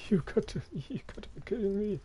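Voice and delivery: very high disbelieving squeal; excited anime fan. Acted. Squeals at slider result. (hohes, ungläubiges Quieken)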